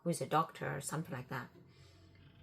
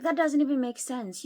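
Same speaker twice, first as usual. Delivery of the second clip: higher, loud incredulous retort; snapping back at the lying boyfriend; angry retort; 7 semitones higher, 10 dB louder than usual. Retold or imitated. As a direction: call out his lie.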